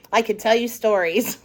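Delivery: plain reading voice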